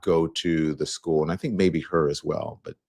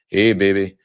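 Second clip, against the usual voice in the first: "Hey, baby" said louder, with a stronger imitation of a Louisiana Bayou accent; 2 semitones higher, 6 dB louder than usual. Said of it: "kind of talk like this".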